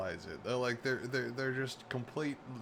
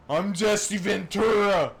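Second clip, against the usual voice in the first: loud, higher, slow hammed-up celebrity voice; Jesse Ventura impression; 7 semitones higher, 8 dB louder, slower than usual. Streamer introducing himself as the clip's star, hammed up. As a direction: announce yourself like a pompous wrestler-governor.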